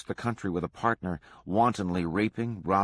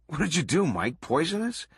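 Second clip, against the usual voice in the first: high-pitched joking accusation; playful mock accusation; 8 semitones higher than usual. A narrator's reading. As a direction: tease him, swooping up on the question. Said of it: "joked Unmissig".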